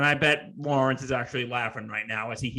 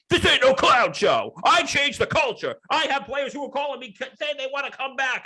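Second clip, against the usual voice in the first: high, loud mock rant; worked-up imitation, pitched way up; Joe Judge going off; 11 semitones higher, 4 dB louder than usual. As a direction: rant like a coach who has lost it. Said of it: "he just kinda went off".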